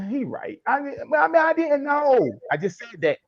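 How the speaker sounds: acted high-pitched reluctant admission; grudging concession. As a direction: backpedal in a high, meek voice. Acts out listener grudgingly conceding.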